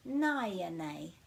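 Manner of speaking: naya nei drawn out slowly, vowel by vowel; slow model word. Shown as a demonstration. Teacher slowing naya nei down so learners can copy. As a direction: spell it out slowly, every vowel broken. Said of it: "break it at every vowel".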